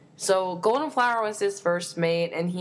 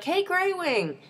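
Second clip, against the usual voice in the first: mock friendly greeting; 10 semitones higher, slower than usual. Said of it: "he's like".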